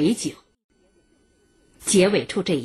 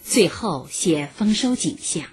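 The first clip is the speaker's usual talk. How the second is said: ordinary talk, nothing marked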